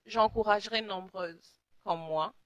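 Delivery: ordinary delivery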